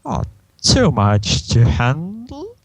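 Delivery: high, slow teasing question; Discord. Acted. Discord taunts his scratcher, slow and teasing. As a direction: tease it out, rising high. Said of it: "Discord starts".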